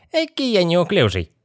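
very high squealing puppet-like voice; nasty squeal. A narrator's reading. Possessed boy popping out of the sarcophagus, nasty squeal. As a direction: squeal it out in a horrid high pitch. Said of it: "мерзким визгливым голосом".

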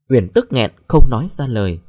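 plain reading voice

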